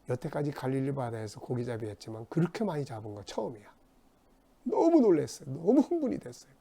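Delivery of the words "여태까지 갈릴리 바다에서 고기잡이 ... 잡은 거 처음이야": ordinary talk, nothing marked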